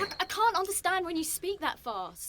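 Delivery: high, flustered stammering start; flustered protest. Acted. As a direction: plead that you can't keep up. Izzy flustered before her scolding boss.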